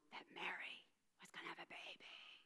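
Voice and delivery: hushed whisper; gossipy whisper. A narrator's reading. Rumors spreading about Mary.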